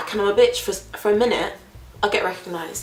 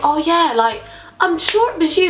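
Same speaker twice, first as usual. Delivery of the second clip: higher, insistent voice; pinning her down; imitating the driver; 7 semitones higher than usual.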